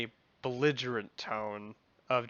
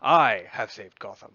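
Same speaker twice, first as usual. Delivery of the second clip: villain voice; 11 dB louder than usual. Acted. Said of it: "There we go".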